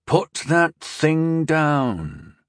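slow, growled command; drawn-out, irritated order. A narrator's reading. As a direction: growl it out word by word. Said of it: "growled Rondal".

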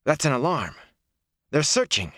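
high, urgent outburst; alarmed. A narrator's reading. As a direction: raise the alarm with pitch up. Bells crash, he jumps up alarmed.

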